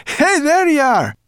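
very high, loud, drawn-out call; excited cry. A narrator's reading. Sorrel running to her dragon friend, loud excited cry. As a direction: holler a joyful greeting, high and slow. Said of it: "she cried".

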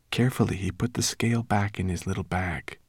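ordinary delivery

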